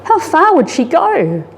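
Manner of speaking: usual speaking voice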